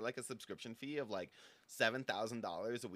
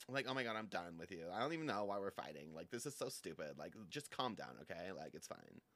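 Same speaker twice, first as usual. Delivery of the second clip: fading; 4 semitones lower, 7 dB quieter, faster than usual. Acted. Fading out of the fight.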